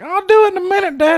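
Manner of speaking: put-on very high, whiny teenage voice; teen Rome answering his father in a high whine; impression of Rome. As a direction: squeak it out like a fourteen-year-old boy. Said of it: "spot-on impression of Rome".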